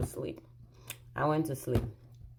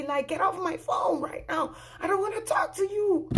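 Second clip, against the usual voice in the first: high, indignant retold tirade; acting out a fed-up rejection on the phone; forceful scolding climbing in pitch; angry telling-off; 7 semitones higher, 4 dB louder than usual. Tells husband off, hangs up.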